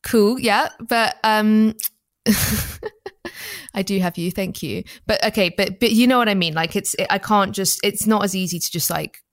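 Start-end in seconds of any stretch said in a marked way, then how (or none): 2.2-3.7 high laugh. Real, not acted. Laughs after mock sob.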